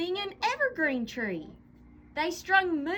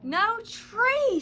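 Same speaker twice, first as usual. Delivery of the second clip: sad sigh; 7 semitones higher, 7 dB louder than usual. Acted. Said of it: "sighed a sad Moose".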